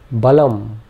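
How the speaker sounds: balam with the final m hummed through the nose; nasal M ending. Shown as a demonstration. Teacher demonstrating the nasal M, balam hummed. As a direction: close balam on a nasal hum. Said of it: "we can pronounce it like a nasal M".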